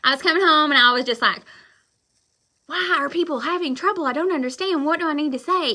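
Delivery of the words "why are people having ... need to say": higher-pitched mock bewilderment; replaying an exasperated inner question; baffled. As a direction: act out puzzled frustration in a raised pitch. Voices her own baffled thoughts.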